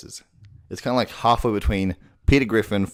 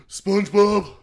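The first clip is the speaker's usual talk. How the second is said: much higher, breathy dopey call; Patrick voice; 12 semitones higher, 4 dB louder, slower than usual. Shown as a demonstration. Voice tutor demoing Patrick calling SpongeBob. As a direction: exhale the name like a happy idiot. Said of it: "exhale on every single word".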